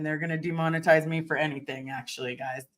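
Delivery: ordinary talk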